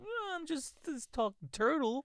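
very high cartoon voice; silly high-pitched turtle; talking turtle. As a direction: protest as a squeaky little creature.

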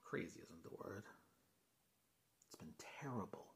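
very soft, low, near whisper; hushed dismay. Acted. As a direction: let it sink almost to a whisper, dismayed. Confiding bad news about work.

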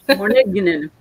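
Morninginen said as one long made-up word, mor-ning-i-nen; playful mispronunciation Morninginen; mock Welsh-sounding word. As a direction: stretch morning into Morninginen with a falling end. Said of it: "Sounds Welsh".

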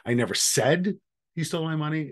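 "said" lifted high and leaned on; stress on said. Shown as a demonstration. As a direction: stress "said" with a rising pitch, then pause. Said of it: "depending on which word you emphasize".

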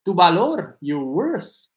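pronouncing "worth" for learners; careful worth. Shown as a demonstration. Teacher modelling "worth" for a viewer. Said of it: "¿cómo ocupar y pronunciar worth?".

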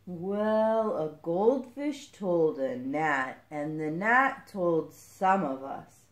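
very slow, drawn-out character voice; plodding turtle voice, words stretched out. Acted. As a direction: take your time, like an unhurried turtle. Turtle character answers slowly.

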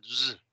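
Z sola con un leve zumbido; Z vibrante. Shown as a demonstration. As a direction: ponle vibración a la Z. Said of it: "que vibre un poquito". (sonido sonoro de Z)

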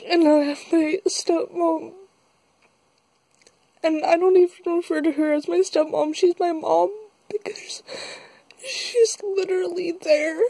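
tearful, high, breaking voice; fighting back tears while talking; after crying. Real, not acted. Breaks down telling of her mother.

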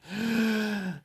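high startled gasp; sharp gasp. Real, not acted. Gamer gasping at the big loot. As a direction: gasp in surprise.

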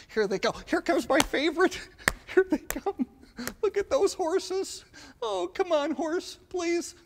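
high, eager pleading to the horse; kid excitement. Retold or imitated. Child at the parade urging the horse, high and eager.

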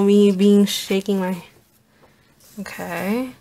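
ordinary talk, nothing marked

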